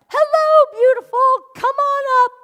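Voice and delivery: high, loud sing-song greeting; cheery cashier call. Retold or imitated. Third time, louder.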